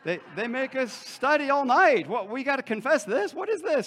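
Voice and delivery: loud, high-pitched incredulous outburst; mock exasperated protest. Student baffled that anyone confesses this, loud mock exasperation. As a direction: protest loudly, voice climbing at the questions.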